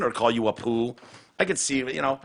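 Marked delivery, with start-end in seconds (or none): none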